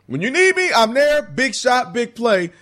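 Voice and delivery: high-pitched acted pledge; high, punchy hero's assurance; clutch player's promise. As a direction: play the reliable teammate, pitched well up. Voicing a dependable clutch player.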